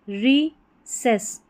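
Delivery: रिसेस slowly, split into "ri" and "ses"; syllable-by-syllable pronunciation. Shown as a demonstration. Teacher giving an example of the ending, रिसेस slow and split. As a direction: break रिसेस in two with a clear gap. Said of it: "सेस करके इसका उच्चारण होगा".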